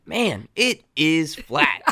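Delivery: drawn out very slowly with a laugh; slow amazed drawl. Retold or imitated. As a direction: stretch it out, chuckling at how flat it is. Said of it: "I was like".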